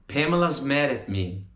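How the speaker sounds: ordinary talk, nothing marked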